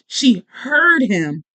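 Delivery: "heard" drawn out very slowly; slow, pointed emphasis on "heard"; stress on HEARD. Real, not acted. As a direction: lean hard and long on "heard". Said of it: "listen to what I said".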